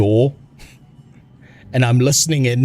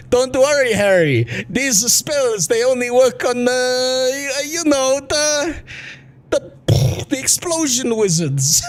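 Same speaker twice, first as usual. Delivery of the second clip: high put-on Yiddish accent; 12 semitones higher, slower than usual. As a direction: Yiddish accent, fumbling and stammering. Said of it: "him being like".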